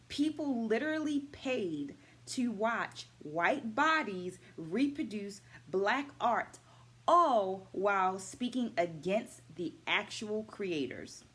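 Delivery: slow, weighty emphasis on each phrase; emphatic slow point. Real, not acted. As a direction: lean hard into the injustice, slowly. Indignant point about minstrel history.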